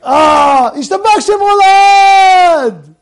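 loud, high, long-drawn cry; hammed-up shout of thanks; exultant praise. Shown as a demonstration. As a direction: belt the blessing out, stretching the last word. Acts praising God after small mishap.

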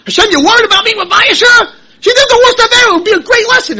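high, loud outraged cry to God; indignant protest. Acted. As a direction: protest to Hashem, voice breaking high.

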